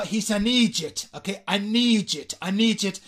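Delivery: "idiot" said as eejit; Irish "idiot". Shown as a demonstration. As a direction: turn "idiot" into two syllables, EE-jit. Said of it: "así es como se dice en". ("idiot" a la irlandesa, "íyit")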